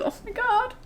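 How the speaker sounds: high breathless oh my God; excited. Real, not acted. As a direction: still stunned with joy. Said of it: "I'm so excited".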